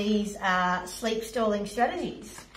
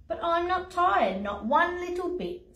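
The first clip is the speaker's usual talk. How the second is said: insistent lion; 8 semitones higher than usual. Acted. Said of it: "said the lion".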